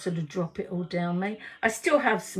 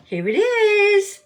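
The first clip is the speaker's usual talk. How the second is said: excited; 13 semitones higher, 9 dB louder, slower than usual. Real, not acted. Finds the lipstick.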